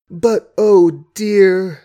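put-on a drawn-out vexed young woman; vexed sigh. A narrator's reading. Margaret alone after Hugh leaves, exasperated.

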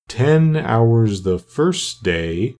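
very slow, long-drawn vowels; doleful turtle. Acted. Mock Turtle lists his lessons for Alice, slow and doleful. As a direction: stretch each subject out ponderously.

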